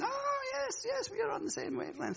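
high, soft mock agreement; lightly voiced knowing listeners; voicing the congregation nodding along, mock agreeing; imitating the congregation. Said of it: "I can see you doing".

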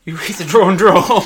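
loud, teasing jab at the name. Real, not acted. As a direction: call it out loudly to tease. Teasing co-host about genre name.

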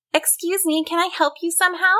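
high-pitched, quick helpful question; insistent call. A narrator's reading. Susan offers help to the troll, high insistent call. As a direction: pipe up high to be noticed. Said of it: "louder this time".

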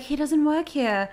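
a touch of imitated disdain; haughty. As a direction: look down your nose at the customer. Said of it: "I think it was haughty".

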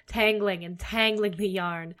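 ordinary delivery, nothing marked

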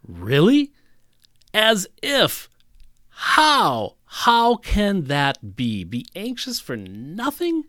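high, loud, disbelieving "Really? As if, how?"; incredulous. Retold or imitated. Preacher imitating a doubter's reaction to the verse, high and incredulous. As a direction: voice a listener who can't believe the verse. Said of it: "to go".

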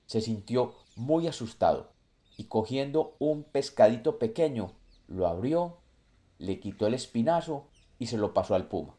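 usual speaking voice

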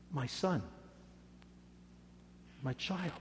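loving father soothing his son; tender endearment. Acted. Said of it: "terms of endearment".

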